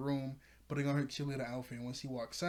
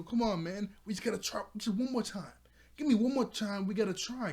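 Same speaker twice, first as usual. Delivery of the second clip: higher-pitched urging of reluctant friends; pleading; 7 semitones higher, 4 dB louder than usual. Retold or imitated. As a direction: plead for another chance, voice raised in pitch.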